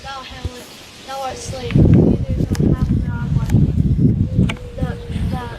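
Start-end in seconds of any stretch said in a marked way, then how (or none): 1.8-3.5 high, loud anguished shout. Acted. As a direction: cry it out in agony. Dying Laertes cries out.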